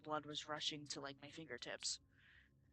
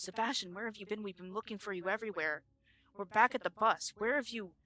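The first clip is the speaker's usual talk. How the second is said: raised, higher voice of an urgent teacher; worried teacher calling out; 5 semitones higher, 9 dB louder than usual. Retold or imitated. Teacher finding a lost student on the trail, urgent raised voice. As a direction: call out urgently to a missing pupil.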